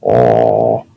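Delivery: low, drawn-out oh; deep tone. Shown as a demonstration. Teacher showing spread-out waves of a low pitch, deep tone. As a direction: sink into a deep note. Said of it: "when I talk with a deep tone".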